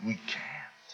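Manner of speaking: hushed, breathy we can't; whispered aside. Acted. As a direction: drop to a whisper to let it land. Aside after the triple.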